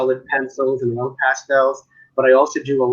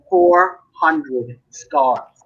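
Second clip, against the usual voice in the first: slow emphasis; much slower than usual. Real, not acted. Emphasising big number.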